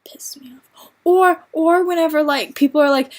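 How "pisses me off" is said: whisper. Real, not acted. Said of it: "whispered aside".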